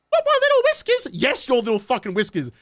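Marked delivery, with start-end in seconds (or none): 0.1-1.1 very high mock pet-owner squeal; mockingly cutesy, high-pitched owner; doting cat owner. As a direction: protest in a silly high falsetto.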